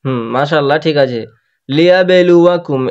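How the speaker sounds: usual speaking voice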